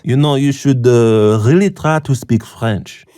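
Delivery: hammed-up French accent, haughty; obnoxious French accent. Homeless man scolding a tourist, obnoxious French accent. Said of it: "in the most obnoxious French accent".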